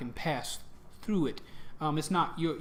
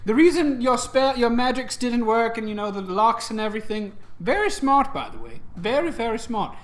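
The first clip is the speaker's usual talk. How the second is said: higher, lively god talking down to mortals; lightly put-on, pitched-up deity voice; lightly voiced playful god; 6 semitones higher, 4 dB louder than usual. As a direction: play a god showing off a little. Plays the god of gnomes.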